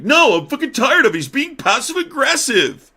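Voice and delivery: high-pitched, loud aggrieved complaint; J D Vance. Acted. Jealous vice president complaining to his boss, mock yelling. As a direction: whine and yell like a jealous tattletale. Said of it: "J D Vance goes to eat, uh, Trump and he's like".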